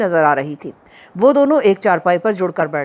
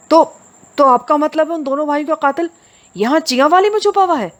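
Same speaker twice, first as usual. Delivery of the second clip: much higher, stammering disbelief; astonished; 8 semitones higher than usual. Acted. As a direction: ask in open amazement. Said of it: "लाखों ने हैरत से". (हैरत से, ऊँची आवाज़ में)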